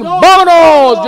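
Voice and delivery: vámonos shouted high and drawn out; slow, loud, high vámonos; loud announcer call. Acted. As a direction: launch the show with a big vámonos. Announcing his grumpy old man show.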